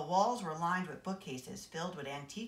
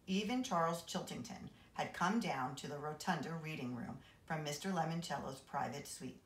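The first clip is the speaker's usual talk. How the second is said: ordinary delivery, nothing marked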